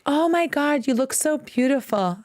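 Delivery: high mock-sweet praise; fake gushing. Teacher imitating two-faced Brazilian praise, high and fake-sweet. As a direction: fake the admiration.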